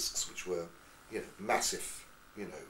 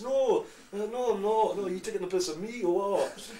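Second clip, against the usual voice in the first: high, protesting Scottish voice; Scottish accent; 11 semitones higher, 6 dB louder than usual. Retold or imitated. Mimic denying he's mocking a Scot, protesting Scottish accent. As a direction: deny it indignantly in a put-on Scottish accent. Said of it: "Meet somebody who's Scottish".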